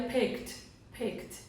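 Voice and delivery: "picked" as one syllable ending KT. Shown as a demonstration. Teacher modelling for learners, "picked" with final T sound. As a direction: drop the E in "picked", K straight into T. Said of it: "ele vai ter o som de T".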